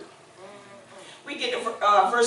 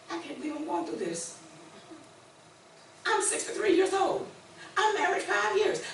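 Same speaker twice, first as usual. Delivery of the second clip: higher, plaintive self-pity; imitated self-pity lament; 6 semitones higher than usual. Believer sulking in their own mind, mock lament. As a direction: whine to yourself like the victim. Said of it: "you're like".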